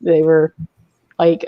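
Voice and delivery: ordinary delivery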